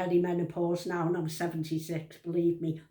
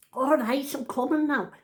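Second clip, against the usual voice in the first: higher mock call across a street; calling back; 5 semitones higher, 3 dB louder than usual. Nan answering her granddaughter from afar, calling back. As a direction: answer a shouter with raised pitch.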